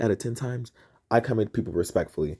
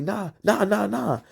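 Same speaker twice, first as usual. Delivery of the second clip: higher, heated retold protest; rising heated refusal, a bit louder; getting loud; 9 semitones higher than usual. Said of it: "I start getting loud".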